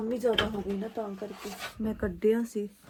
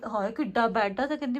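ordinary talk, nothing marked

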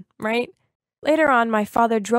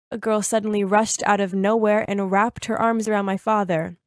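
ordinary delivery, nothing marked

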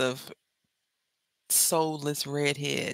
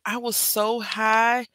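higher and slow, dreamy and woozy; drugged-up drawl; 5 semitones higher, 3 dB louder, much slower than usual. Retold or imitated. Telling viewers about the surgery, woozy drugged drawl. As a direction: stretch it out like you're floating on meds.

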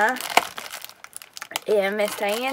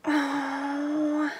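ordinary talk, nothing marked

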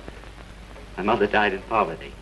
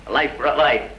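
louder and higher, gloating; gloating declaration; 6 semitones higher, 4 dB louder than usual. Acted. Killer gloating to the guests over his revenge, louder. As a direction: savour the revenge out loud.

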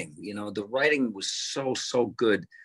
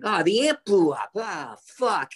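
high, whiny complaining musician; a much higher, whiny Fry-like complaint; whiny young self; 10 semitones higher than usual. Retold or imitated. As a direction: whine it like a spoiled young guy. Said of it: "I was like that, annoying".

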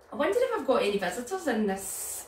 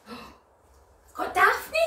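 delighted gasp; 8 semitones higher, 6 dB louder, much slower than usual. Acted. Revealing a puppet to children.

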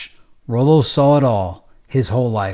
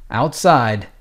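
usual speaking voice

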